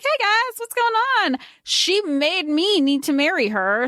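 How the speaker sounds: very high, playful hammed-up voice; put-on caricature. Podcaster voices Oak playing his caricature to the others, hammed-up. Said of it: "leans into this caricature that he created".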